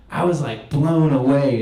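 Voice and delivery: normal talking voice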